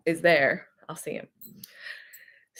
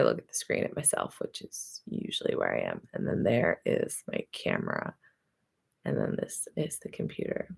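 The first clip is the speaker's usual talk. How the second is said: quiet aside; 12 semitones lower, 8 dB quieter than usual. Real, not acted. Muttered aside about camera setup.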